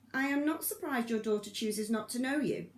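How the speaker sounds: Anna snapping at the governor; snapped. A narrator's reading. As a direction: snap it at him with scorn. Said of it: "snapped Anna".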